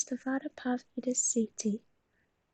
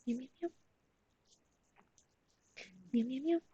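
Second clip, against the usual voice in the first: mock meowing; 5 dB quieter, much faster than usual. Meowing at the cat.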